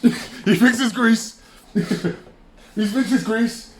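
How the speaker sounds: slow words through real laughter; giggling in disbelief; laughing. Real, not acted.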